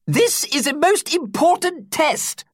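put-on high squeaky old teacher, slowed. A narrator's reading. Teacher squeaking to his class about the test in a high squeak. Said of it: "squeaked Sherbet Lemon".

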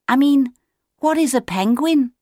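put-on high, childlike insistence; insistent chick. A narrator's reading. Otto to Claudius wanting to know, high insistent question. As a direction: press the question in a high young voice.

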